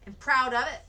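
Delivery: defiant. A narrator's reading. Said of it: "attempting to stare him down".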